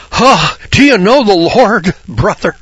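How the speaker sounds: high, loud, slow pious mimic; mocking door-knocker. Preacher mimics pious door-knockers asking about the Lord. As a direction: ham up a preachy stranger at the door. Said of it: "People ask me that".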